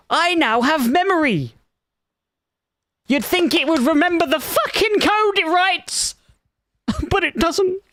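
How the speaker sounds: incredulous sarcastic grumble; exasperated rant. Real, not acted. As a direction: vent at the absurdity. Exasperated complaint.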